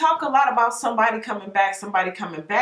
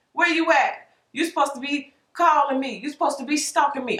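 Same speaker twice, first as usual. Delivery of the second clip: higher, put-out demanding call; the dream calling after you; 6 semitones higher than usual. Pretend. Dream complaining the dreamer stopped calling, higher and indignant. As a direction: pitch it up like a clingy partner asking where you went. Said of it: "And the dream's like".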